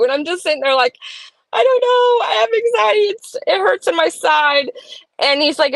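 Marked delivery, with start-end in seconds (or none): 1.5-4.7 high-pitched, tearful imitation of panic; retold helpless wail, easing down in pitch; mock anxious whine. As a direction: whine it high, like a worried kid. Acts out own anxious, pained reply.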